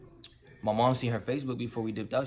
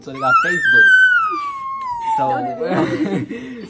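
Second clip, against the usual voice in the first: high squeal of laughter; laughing; 17 dB louder, much slower than usual. Real, not acted. Young dad telling friends his old parents use Facebook, squealing with laughter.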